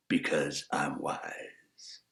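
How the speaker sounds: slow hushed whisper; secretive whisper. Acted. Wise man confiding his wisdom in a whisper. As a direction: breathe it like a secret.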